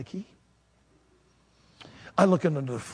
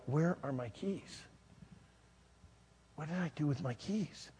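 puzzled, to himself; 11 dB quieter than usual. Retold or imitated. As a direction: quietly wonder to yourself where something went. Acts searching for keys.

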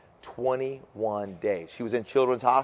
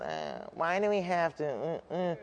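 whining; 2 semitones higher, 10 dB quieter than usual. Shown as a demonstration. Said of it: "There's just nothing worse than".

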